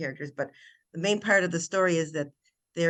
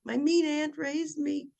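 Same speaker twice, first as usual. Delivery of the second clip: put-on high, small, childlike voice, much higher and slow; villain's childlike reply; 12 semitones higher than usual. Villain shrunk to a boy, answering Lily's kiss, in a high childlike voice. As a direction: speak like a timid little boy who has never been kissed, high and slow. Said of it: "And he says".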